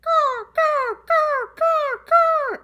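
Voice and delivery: high, loud crow cawing; be the crow calling out; imitated crow caws. Retelling the crow in the front yard, high cawing. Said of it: "He went".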